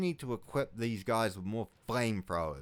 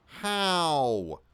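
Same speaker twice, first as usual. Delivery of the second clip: stunned disbelief; 6 semitones higher, 5 dB louder, much slower than usual. Real, not acted. Player misclicks and loses units.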